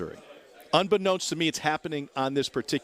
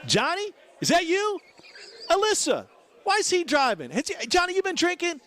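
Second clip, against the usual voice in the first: much higher, incredulous sheriff voice; surprised sheriff; 3 dB louder than usual. Retold or imitated. Sheriff peering into the car, surprised to find Johnny. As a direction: act startled, recognizing the driver. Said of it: "the sheriff peers in and goes".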